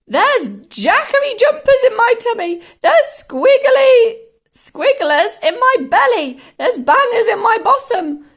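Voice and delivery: high, loud wailing; yelled complaint. A narrator's reading. Grandma complaining of her insides, high yelling. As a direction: screech each ailment with relish. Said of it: "she yelled".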